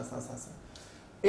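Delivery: सा, सा, सा breathed slowly in a soft whisper; whispered hiss. Shown as a demonstration. Teacher showing students their one-size-fits-all स sound. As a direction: hiss सा three times, drawn out and voiceless. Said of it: "एक ही स बोलता है आपसे".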